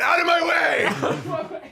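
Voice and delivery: slow, high, loud shout; mock frantic shout. Doctor rushing to help, slow high shout. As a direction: bellow it like clearing a hallway.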